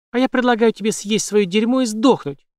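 high, loud enraged retort; furious yell. A narrator's reading. Jane hurling insults at the villain, high furious yell. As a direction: throw the insult back at him.

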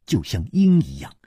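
usual speaking voice

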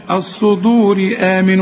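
slow الصدور joined to آمن by thin "ri"; light linked r. Shown as a demonstration. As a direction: recite through الصدور with light r. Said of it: "رققها". (ترقيق راء الصدور عند الوصل)

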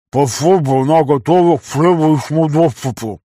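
mumbling through a full mouth; slow, chewing while talking. A narrator's reading. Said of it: "с набитым ртом пробормотал Вася".

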